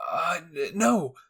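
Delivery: flustered stammer. Acted. Eren flustered by Levi.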